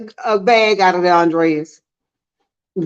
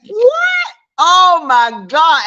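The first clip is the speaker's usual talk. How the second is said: shocked; 2 semitones higher, much slower than usual. Real, not acted. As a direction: shoot the voice way up in disbelief. Reacting to a viewer's comment.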